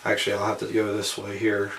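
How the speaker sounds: usual speaking voice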